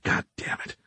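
voiceless hushed curse; whispered curse. Acted. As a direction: hiss it under your breath. Cursing under breath.

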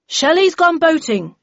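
high, loud, drawn-out call; voice from the back. A narrator's reading. Someone at the back answers roll call, calling out. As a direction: call across the room. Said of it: "said a voice at the back".

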